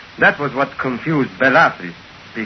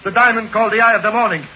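agitated insistence; 9 semitones higher than usual. Acted. Simon agitated about his diamond.

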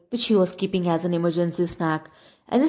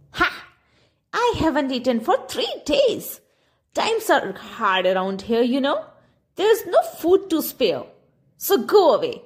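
put-on cranky old woman; 7 semitones higher than usual. A narrator's reading. Old woman refuses to share food, scornful and high. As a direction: scoff and send him packing.

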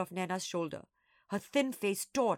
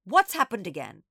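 loud, sharp demand; stern teacher's question; snapped; 9 dB louder than usual. A narrator's reading. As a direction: demand an answer impatiently. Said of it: "the Doberman snapped".

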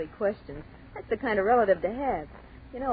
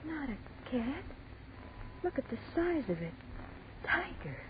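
hushed alarm; 5 semitones higher, 11 dB quieter than usual. Acted. Spotting a big cat.

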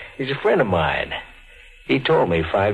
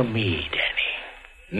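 menacing whisper; 8 dB quieter, much slower than usual. Acted. Chigger taunting Danny to dance, menacing whisper. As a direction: breathe his name in a whisper.

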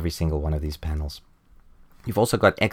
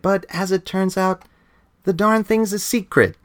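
much higher, hammed-up stuffy toastmaster; mock-pompous Victorian gentleman's announcement; club gentlemen toast; 11 semitones higher than usual. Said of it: "And they're saying".

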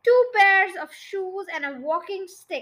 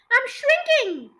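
high, slow alarmed cry; brubbled; 9 semitones higher, 4 dB louder than usual. A narrator's reading. Husband panicking while shrinking, high alarmed cry. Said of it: "brubbled Mister Twit".

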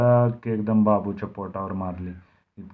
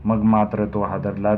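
plain reading voice

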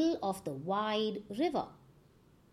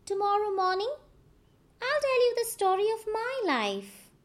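higher, quick trickster voice; Anansi's voice; 9 semitones higher, faster than usual. A narrator's reading. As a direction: make excuses like a sly spider. Anansi promises a story.

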